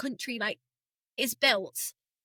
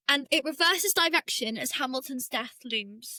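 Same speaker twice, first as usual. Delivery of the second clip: usual speaking voice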